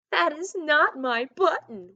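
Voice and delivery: a high, loud mock wail with a touch of strain. Toad wailing to the raccoon in mock despair. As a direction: wail it like a storybook toad near tears.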